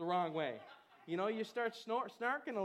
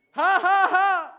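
loud laughter; 8 semitones higher, 17 dB louder, slower than usual. Real, not acted. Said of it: "leads laughter".

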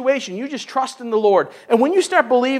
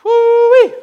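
high, loud celebratory whoop; joyful whoop; 12 semitones higher, 8 dB louder than usual. Acted. Preacher whooping for joy before his congregation. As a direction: let out a happy holler.

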